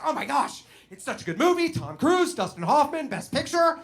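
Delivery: high, loud gushing enthusiasm; gushing parent. Retold or imitated. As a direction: rave like a delighted parent. Parents get so excited.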